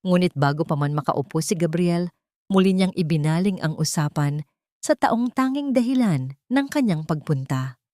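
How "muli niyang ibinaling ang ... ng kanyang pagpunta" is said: plain reading voice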